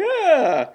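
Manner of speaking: a high, loud imitated yell "Ah"; tied up and blindfolded, imitated cry of pain; Hanks bellow. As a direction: let out a sudden anguished bellow. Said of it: "a lot of like".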